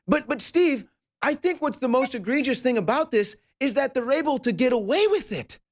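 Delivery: high-pitched, incredulous protest; exasperated disbelief. Real, not acted. Host to guest about them getting away with it, incredulous. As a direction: protest in rising amazement.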